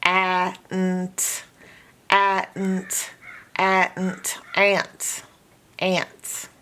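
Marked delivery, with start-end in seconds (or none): 0.1-4.4 "a, nt" split, short A as in cat, then nt; sounded out. Shown as a demonstration. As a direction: blend "a, nt" piece by piece. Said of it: "its normal short A sound".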